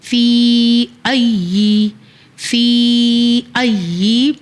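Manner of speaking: very slow, drawn-out chanted blend; slow Quranic chant; slow recitation. Shown as a demonstration. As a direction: stretch فی ای like recitation. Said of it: "یا زیر ای".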